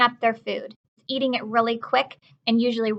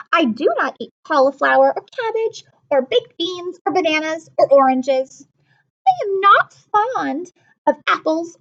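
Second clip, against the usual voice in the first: fussy little sister; 6 semitones higher, 3 dB louder than usual. Acted. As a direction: pout like a picky little girl. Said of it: "and she said".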